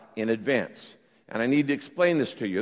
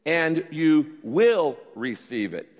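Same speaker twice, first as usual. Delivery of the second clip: slow, with "will" punched; deliberate verse reading, "will" stretched and emphasized; stress on will; slower than usual. Shown as a demonstration. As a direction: point up the future tense by leaning hard on "will". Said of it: "Notice the change in tense here".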